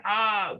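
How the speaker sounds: high imitated crowd ah of delight. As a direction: mimic the audience's delighted ah. Audience reacts to the reveal.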